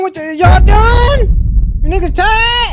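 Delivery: shouting up at the chat; rally the tired crowd, loud and high; hype shout. Real, not acted. Host hyping the chat.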